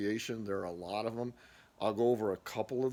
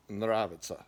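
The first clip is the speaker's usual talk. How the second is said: ordinary talk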